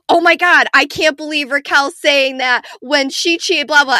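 can't hold it in, blurt it out; outburst. Retold or imitated. Wife bursts out at the TV beside her husband, loud. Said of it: "I couldn't even help myself".

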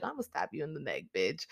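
low-key playful stab threat; hushed mock threat. Joking about her own catchphrase, hushed mock threat.

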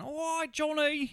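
very high, drawn-out neighbour; hammed-up window greeting; mock calling out. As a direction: call out slowly in a silly high voice.

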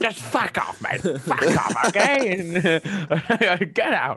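mock Aussie shout.

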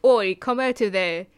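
bad mock Irish accent, called out loud; a hammed-up Irish shout; loud playful fake Irish accent. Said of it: "That was my Irish accent".

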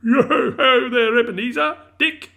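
loud, high, rich jovial holler; hearty boss hailing his apprentices; jovial call. A narrator's reading. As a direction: call out in a fat, oily, jovial voice. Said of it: "called out in a comfortable, oily, rich, fat, jovial voice".

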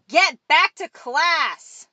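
slow, high, loud order; shouted back. A narrator's reading. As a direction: yell it back as a chorus. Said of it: "Five individuals shouted back".

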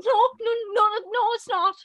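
high, whiny string of noes; mock frantic denial. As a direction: squeal the noes like a scandalised sibling.

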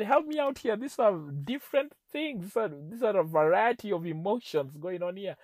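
high, loud baffled plea; retold exasperation. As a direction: plead loudly for some sense, acting it out. Acting out his exasperated plea.

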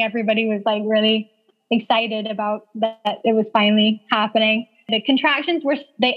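ordinary talk

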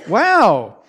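ordinary delivery

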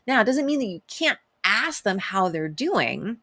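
ordinary talk, nothing marked

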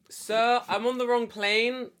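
high, loud, drawn-out mock dismay; mock bewilderment. Acted bewildered passenger addresses fisherman.

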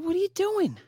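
very high, incredulous squeak; retold disbelief. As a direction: ask it in mock bewilderment, way up high. Retold reaction to the spraying.

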